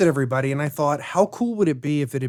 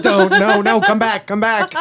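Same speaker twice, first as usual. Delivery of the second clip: loud, higher-pitched calling out; mock pleading; 6 semitones higher, 5 dB louder than usual. Co-host drifting into fancy words, mock frantic calling. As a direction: call them back in playful panic.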